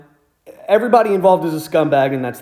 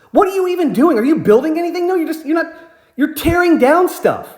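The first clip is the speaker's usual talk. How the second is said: higher-pitched, exasperated tirade retold; retold furious rant; 4 semitones higher than usual. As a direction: berate them, pitch jumping up in indignation. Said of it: "I'm just furious mad".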